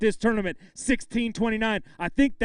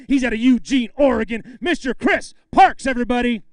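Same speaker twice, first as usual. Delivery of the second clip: announcer intro; 4 semitones higher, 7 dB louder than usual. Real, not acted. Emcee introducing the angler to the crowd, booming announcer. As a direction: present him to the crowd with a big build.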